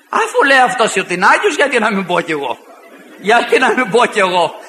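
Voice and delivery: laughing mockery. Mocking heretics' claims.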